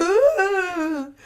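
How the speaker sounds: high, loud ghostly ooh with laughter; laughing ghost moan. Shown as a demonstration. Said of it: "Moan like a ghost?".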